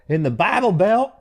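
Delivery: high-pitched comic holler; imitated yell. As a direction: yell it out like the comedian's catchphrase. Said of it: "he'll yell".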